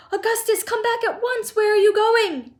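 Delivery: very high, frantic scolding; screamed. A narrator's reading. Mother calling son back from pipe, screaming. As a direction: shriek after the vanishing boy. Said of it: "screamed Missus Gloop".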